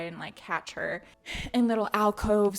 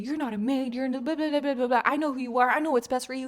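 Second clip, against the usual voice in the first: higher, heated retold accusation; mock yelling; 4 semitones higher, 4 dB louder, faster than usual. Retelling him confronting the maid about her identity, mock yelling. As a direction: imitate an indignant telling-off, voice raised. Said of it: "he starts yelling at her".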